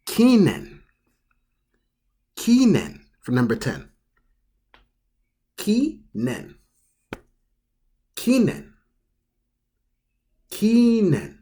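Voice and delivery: kinen said as kee-nen with even syllables; Japanese pronunciation. Shown as a demonstration. Teacher drilling the word for anniversary, careful demo. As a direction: kinen repeated slowly and clearly. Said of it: "you pronounce this as".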